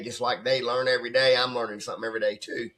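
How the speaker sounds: ordinary talk, nothing marked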